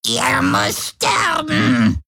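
put-on piercing, very high shriek of an old hag; shrieking crone. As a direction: screech the accusation like a crazed old woman. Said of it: "kreischte die Alte".

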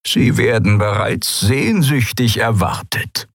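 put-on slow, glad old-cat welcome; happy old Skria. A narrator's reading. Suran welcoming the crew home, slow and glad. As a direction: greet an old friend with joy. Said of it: "Der alte Mann lächelte".

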